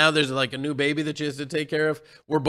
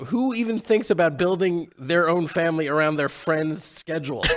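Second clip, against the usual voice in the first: ordinary delivery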